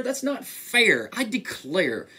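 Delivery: high-pitched storybook grumble; complaining bear. A narrator's reading. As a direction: protest as a put-upon bear. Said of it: "said the bear".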